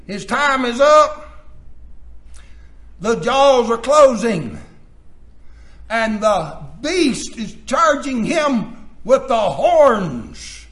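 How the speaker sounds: loud, dramatic pulpit warning; full-force preaching. Acted. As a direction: build the danger with heavy pauses between phrases.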